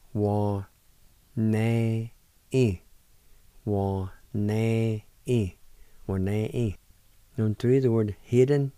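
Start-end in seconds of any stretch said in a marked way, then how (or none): 0.1-2.8 "Wa", "ne", "i" given one at a time with pauses; syllable by syllable. Shown as a demonstration. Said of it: "And it's pronounced like this". 3.6-6.8 "Wa ne i" drawn out slowly and clearly; slow model word. Shown as a demonstration. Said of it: "And it's pronounced like this".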